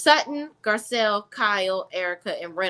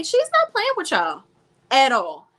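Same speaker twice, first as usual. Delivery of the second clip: high sassy emphasis; 5 semitones higher, 4 dB louder than usual. Acted. Hyping up the cast member.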